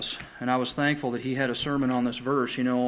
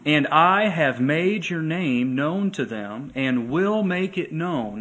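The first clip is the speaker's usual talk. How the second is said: slow, louder, solemn reading of the prayer; solemn scripture reading; 4 dB louder, slower than usual. Acted. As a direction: read it slow and weighty, as a prayer. Reading Jesus' prayer aloud.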